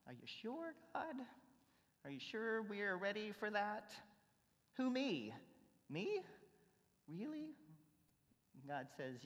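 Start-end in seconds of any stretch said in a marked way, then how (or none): 0.1-3.9 a touch of a higher, softer unsure voice; timid questioning of God; doubtful believer. Pretend. Preacher voicing a doubting believer, lightly higher and unsure. 4.8-7.5 higher, disbelieving questions; can't believe it's me; incredulous. Pretend. Believer answering God's call, higher and disbelieving. Preacher voicing surprised self.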